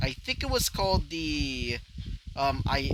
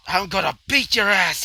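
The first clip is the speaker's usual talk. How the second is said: loud, raised-pitch threat; screamed out; 5 semitones higher, 9 dB louder than usual. Acted. Hot-headed friend charging in, screamed threat. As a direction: yell the threat at full force. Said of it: "he would scream out".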